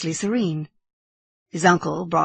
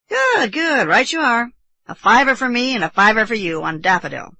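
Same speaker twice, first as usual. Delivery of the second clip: loud, higher, jovial agreement; hearty approving uncle; 6 semitones higher, 7 dB louder than usual. Acted. Uncle agreeing to nephew's bet, hearty. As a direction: boom approval with swooping pitch.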